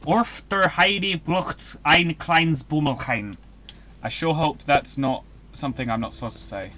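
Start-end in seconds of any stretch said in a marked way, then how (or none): 0.1-3.4 slow, careful mock German reading; half-sung German nursery rhyme, slow. Shown as a demonstration. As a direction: sound out the German words slowly. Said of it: "How was my pronunciation?".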